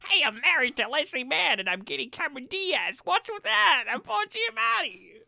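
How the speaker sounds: high, swooping comic actor voice; hammed-up, cocky high-voiced impression; Paul Giamatti impression. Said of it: "that's how he sounds".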